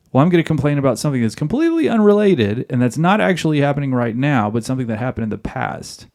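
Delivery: mock the changed subject quickly; fast mocking. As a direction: rattle it off fast. Mocking the wife's deflection.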